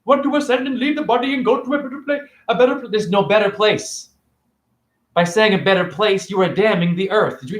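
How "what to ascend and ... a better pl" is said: high, fast sneering take on their words; mocking quote. To a spiritual viewer, a high fast mocking imitation. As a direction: parrot their talk back in a high mocking voice.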